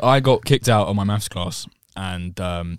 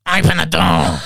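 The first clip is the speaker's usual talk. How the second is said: demanding shout; 7 semitones higher, 5 dB louder than usual. Retold or imitated. Retold shout at the toilet door.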